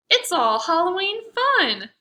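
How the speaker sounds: high, loud and cheery; cheerful. Acted. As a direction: make it sound fun. Pumpkin voices in rhyme.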